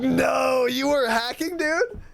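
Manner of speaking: real laughter breaking into a shout; laughing shout. Real, not acted. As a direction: yell it while cracking up. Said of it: "And I start fucking dying".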